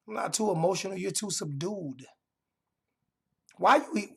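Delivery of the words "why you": ordinary delivery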